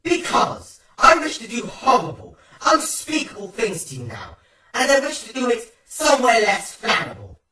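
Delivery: loud, high, hammy villain laughing; hammy stage actor. A narrator's reading. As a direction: gleefully threaten like a stage villain. Villain threatens horrible things, laughing.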